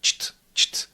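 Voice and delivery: cht with ch and t fused, voiceless; short crisp cht. Shown as a demonstration. Teacher drills the cht cluster. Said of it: "keep them short and keep them crisp".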